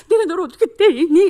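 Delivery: high, loud mock-crying child; imitated tearful cry. Child forced to hit a friend, imitated tearful wail. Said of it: "때리는 애는 울면서".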